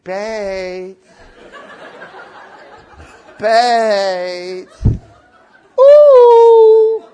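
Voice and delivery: drawn-out sing-song call; sweetly hammed-up summons; friendly calling. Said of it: "Die gaat heel vriendelijk naar Peet toe".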